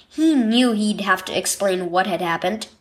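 ordinary delivery

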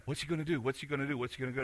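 low, soft repeated wondering; hushed crowd murmur. Retold or imitated. Crowd following Forrest wonders as he stops, hushed murmur. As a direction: voice the onlookers under your breath.

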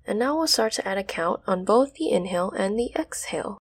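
soft, even meditation voice; guided meditation. Acted. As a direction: speak it gently aloud. Retake of meditation line.

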